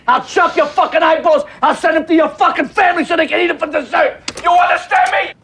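threats climbing to a yell; escalating threat. Real, not acted. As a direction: mobster rage boiling over. Phone threat escalating to shouting.

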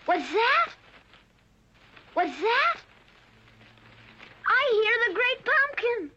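high and eager, straining to hear; thrilled at a sound in the patch; excited. Acted. Linus hears the Great Pumpkin.

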